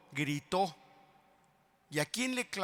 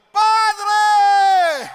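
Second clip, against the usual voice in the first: very high, loud sustained shout; crying out; 18 dB louder than usual. Acted. Jesus crying out to his Father, full shout. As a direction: call out to the Father with everything. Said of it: "gritó".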